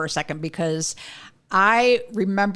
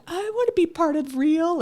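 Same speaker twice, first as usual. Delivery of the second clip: whiny guy friends; 11 semitones higher than usual. Retold or imitated. Guy friends asking to join.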